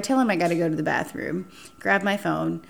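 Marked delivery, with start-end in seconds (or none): none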